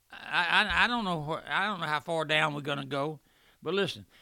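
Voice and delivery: ordinary delivery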